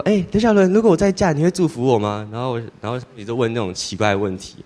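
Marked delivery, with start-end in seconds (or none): 0.0-2.3 much higher, louder mock-mom voice; a daughter mimicking her mother asking, high and coy; imitating mom's coy question. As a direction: play the mother coyly asking her kid's blessing. Mother asks about remarrying.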